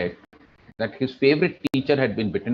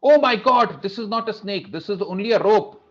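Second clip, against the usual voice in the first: mock startled cry, much higher and louder at first; retold shocked scream; 8 semitones higher, 4 dB louder than usual. Student finding the snake is a rope, retold startled scream. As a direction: shriek it out as he realizes the snake is a rope. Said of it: "he screamed".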